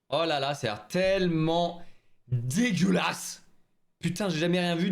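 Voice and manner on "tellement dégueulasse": traîner « dégueulasse » avec dégoût; étirer le mot, écœuré; dégoûté. Real, not acted. Goûte une boule de bonbon. (voix écœurée et traînante)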